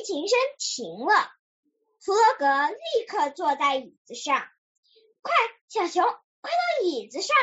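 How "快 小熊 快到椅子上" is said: urgent call. A narrator's reading. Player calls bear to a chair, mild urgent call. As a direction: call a friend over in raised pitch. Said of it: "他叫道".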